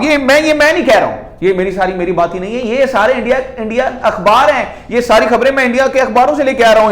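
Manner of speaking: animated, insistent YouTube commentary; emphatic insistence. Real, not acted. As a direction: insist hard, pitch up and push, fast at the end. Insisting the news is from Indian papers.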